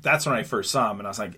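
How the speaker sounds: ordinary talk, nothing marked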